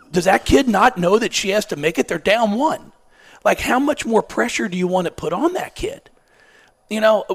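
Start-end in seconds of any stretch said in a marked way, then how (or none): 0.1-6.1 louder, higher, exasperated; incredulous rant. Retold or imitated. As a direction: rant in disbelief at the coach. Coach rants at high school game.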